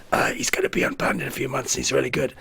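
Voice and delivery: hushed, breathy whisper covering for him; mock whisper; whispered aside. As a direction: whisper it behind his back. Whispered excuse to teammates.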